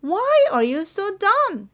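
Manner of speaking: high-pitched, exasperated scolding; sister's yell. A narrator's reading. Big sister telling Nora off, high-pitched yelling. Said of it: "하고 언니가 소리를 쳤습니다".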